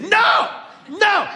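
high, loud, exasperated "No, no."; nearly yelling in frustration; frustrated no. Retold or imitated. Frustrated stranger rejects the answer, loud and high. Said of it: "getting all frustrated".

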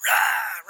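short loud growling roar; sudden wild "rah" of a madman. Acted. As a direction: let out a quick monster roar. Demon-possessed man running at you.

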